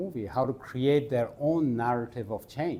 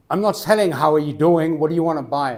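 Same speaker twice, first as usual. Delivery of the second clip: loud, higher, testy rebuff; raised-voice mock irritation; impatient vendor; 3 semitones higher, 8 dB louder than usual. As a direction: act out a shopkeeper cutting off small talk. Acted quarrel, impatient vendor.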